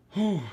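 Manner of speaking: loud winded exhale, high; breathless whew. Real, not acted. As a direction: blow out a tired whew. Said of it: "I'm already out of breath".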